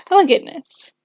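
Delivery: amused exclamation after a real chuckle; chuckle, then exclaim in amusement; real laugh into a lifted exclamation. Real, not acted. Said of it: "I'm just laughing".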